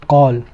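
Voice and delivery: कॉल with a low, rounded "aw"; "o"-coloured vowel in कॉल; heavy rounded sound. Shown as a demonstration. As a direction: drop low on कॉल, say "kawl". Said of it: "भारी sound है".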